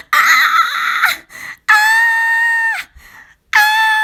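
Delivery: put-on high, loud wordless child's screams; three rising shrieks of rage; screaming tantrum. A narrator's reading. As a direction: scream like a furious little girl. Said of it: "She started to yell and scream".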